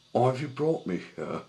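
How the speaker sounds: frightened tugboat character piping up; distressed captive. A narrator's reading. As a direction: demand answers from your kidnappers. Captive Sunshine questions the kidnappers.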